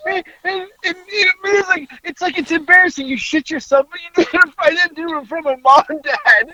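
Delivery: high, breaking voice from real laughter; laughing through words. Real, not acted. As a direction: keep talking through the tail of a laughing fit. Recovering from a laughing fit, still high.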